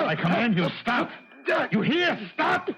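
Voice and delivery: high desperate shouted orders, rising; commanding shout. Acted. Anton ordering the spirit hand off Gordon, shouting.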